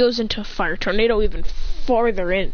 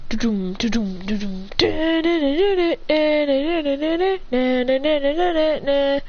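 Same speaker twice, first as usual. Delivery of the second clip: vocalised action theme; imitated power-up; 4 semitones higher than usual. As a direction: drum beats building into a higher da-da run. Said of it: "Adrian goes".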